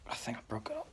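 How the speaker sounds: whispered aside to self; guilty little whisper. Acted. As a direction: breathe it under your breath.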